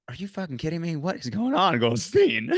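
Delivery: startled worry at a friend's face; alarmed disbelief. Retold or imitated. Retold alarmed reaction to sobbing friend.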